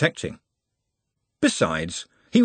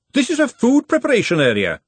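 much higher, louder bossy cook; indignant scolding; 13 semitones higher, 5 dB louder than usual. A narrator's reading. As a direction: lay down the kitchen rule. Cook scolding the dirty boys.

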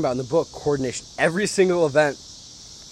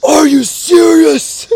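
high, slow, loud stunned disbelief; drawn-out shocked cry of a friend finding his stuff gone; 17 dB louder, much slower than usual. Retold or imitated. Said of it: "my friend goes".

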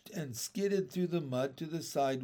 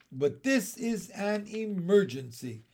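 higher, insistent objection; pointing out urgently; 6 semitones higher, 3 dB louder than usual. A narrator's reading. Teacher argues with the traffic boy, pointing it out insistently. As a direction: press the point on a reluctant helper. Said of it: "Miss Binny pointed out".

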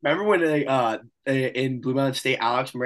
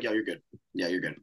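quiet reassurance; 9 semitones lower, 10 dB quieter than usual. Retold or imitated. Officers quietly waving him off.

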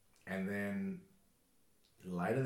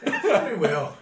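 said through laughter; laughing; 13 semitones higher, 20 dB louder than usual. Real, not acted. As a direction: laugh while teasing him. Laughing teasing remark.

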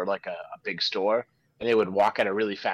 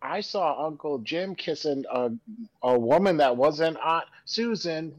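higher-pitched mock little-kid voice; imitated toddler tattling; 6 semitones higher than usual. Toddler telling on Uncle Jim, imitated innocent tattle. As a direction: imitate a child innocently reporting. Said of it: "one day where they'll be like".

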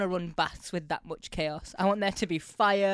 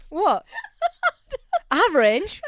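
high, louder words through laughter; laughing; 11 dB louder, slower than usual. Real, not acted. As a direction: giggle it out, pleased with yourself. Laughing at own yell.

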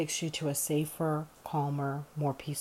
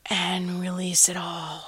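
slow breathy whisper; whispered release; 3 semitones higher, 4 dB louder, slower than usual. Shown as a demonstration. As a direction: let the words out on the breath. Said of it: "So breathing in".

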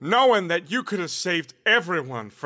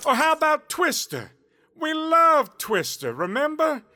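coaxing; 7 semitones higher than usual. Acted. Coaxing Harley with memories.

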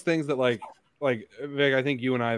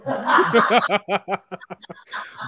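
long laugh; 11 semitones higher, 6 dB louder than usual. Real, not acted. Laughs at friend's joke.

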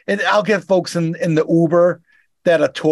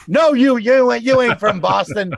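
mock rude caller; 6 semitones higher than usual. Boston driver mimics a rude Amazon caller, mock scoffing. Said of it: "And I'm just like".

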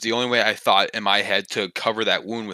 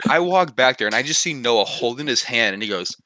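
usual speaking voice

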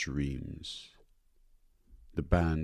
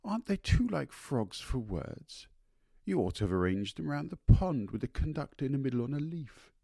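gushing society lady; 8 semitones higher than usual. Acted. Kitty gushing at garden party.

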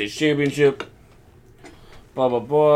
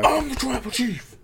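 much higher put-on arrogant voice; mock Roman Reigns; 12 semitones higher, 4 dB quieter than usual. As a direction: sneer it like a cocky champion. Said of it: "he's like".

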